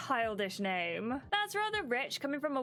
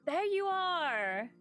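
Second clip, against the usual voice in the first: slow, delighted call-out; excited; 8 semitones higher, much slower than usual. Acted. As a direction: beam as you greet him. Giddy greeting with breakfast.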